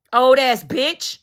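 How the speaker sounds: ordinary talk, nothing marked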